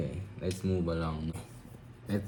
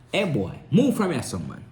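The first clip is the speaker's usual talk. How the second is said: put-on Jamaican accent. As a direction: call out like a street youth. Mock Jamaican street talk.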